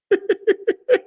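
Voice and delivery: a sudden high burst of laughter. Real, not acted. Laughing to the viewers at the idea of swimming down to the ocean floor.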